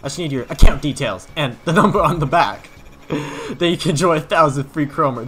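loud, pushy huckster pitch; hard-sell the player like a crazed salesman; weird salesman voice. Acted. Salesman hustling the kid, loud and pushy. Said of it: "the weirdest character I've ever had to voice".

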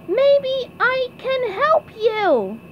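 high, drawn-out cartoon holler; SpongeBob calling out. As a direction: call it slowly through cupped hands, word by word. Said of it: "SpongeBob cupped his hands to his mouth".

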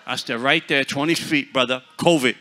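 loud, laughing shout to stay back; mock alarmed shout. Preacher shouting at the man to keep away, loud and laughing.

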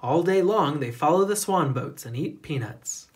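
ordinary delivery, nothing marked

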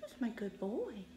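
high cooing praise; high sing-song pet praise. Real, not acted. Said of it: "my baby voice".

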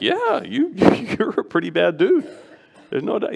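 chuckling through the words; amused, laughing reply to a boastful sinner; laughing along. Retold or imitated. Pastor retelling how he laughed with the man at the altar, chuckling.

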